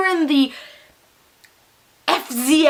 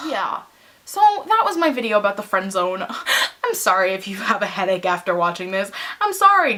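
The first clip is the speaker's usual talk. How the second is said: usual speaking voice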